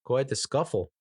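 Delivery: light mock Canadian accent, amused. Canadians watching the American chaos, in a light Canadian accent. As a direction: play a smug Canadian watching across the border, lightly. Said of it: "sitting across the border like".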